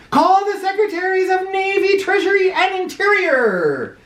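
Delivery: high summons, listing each office; commanding call. Acted. President summoning his cabinet, high and commanding. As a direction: bark out orders to the staff. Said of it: "shouted President Taft".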